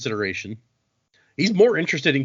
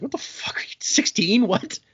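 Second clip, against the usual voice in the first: high-pitched, baffled outburst; hammed-up disbelief, voice shooting up; incredulous; 9 semitones higher than usual. Kelsier baffled by the answer.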